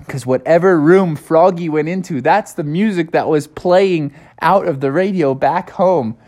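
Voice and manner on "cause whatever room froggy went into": ordinary delivery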